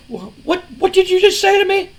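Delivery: high, squeaky stunned disbelief; very high, incredulous mock boss voice; shocked disbelief. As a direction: stammer it out, shocked and outraged. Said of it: "Shane's like".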